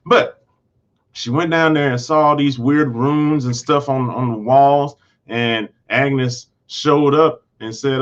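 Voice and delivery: ordinary talk, nothing marked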